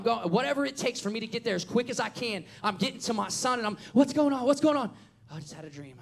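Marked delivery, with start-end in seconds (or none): 3.9-4.9 loud, high, urgent demand; alarmed father. Retold or imitated. Father bursting into son's room. 5.3-6.0 put-on sleepy kid. Son's sleepy answer after waking.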